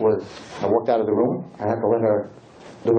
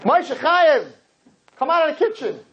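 calling out; 14 semitones higher than usual. Retold or imitated. Rabbi calling his son out of the kitchen, high call. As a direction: summon him from the other room.

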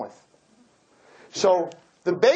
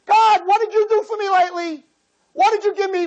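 demanding prayer; 11 semitones higher, 4 dB louder than usual. Retold or imitated.

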